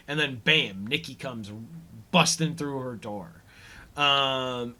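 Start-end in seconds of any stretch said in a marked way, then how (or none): none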